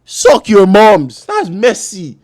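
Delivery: slow, loud, drawn-out taunt; taunting yell. Real, not acted. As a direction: stretch every word to mock them. Taunting rival fans.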